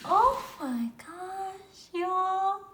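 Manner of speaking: high, loud, slow squeal; stretched squeal. Real, not acted. Fan telling viewers about a photo, slow loud squeal. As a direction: drag it out, overwhelmed.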